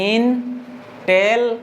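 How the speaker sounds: counting out Intel- by syllable; syllables isolated. Shown as a demonstration. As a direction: stretch इन, then टेल, with a gap. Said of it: "चार syllable हो जा रहा है".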